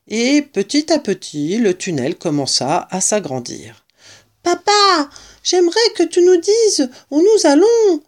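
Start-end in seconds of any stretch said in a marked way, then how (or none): 4.4-8.0 put-on high, loud child's voice; high-pitched, insistent kid calling to Papa; eager child. A narrator's reading. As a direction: pipe up as a child pleading with a parent. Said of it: "dit l'un des enfants".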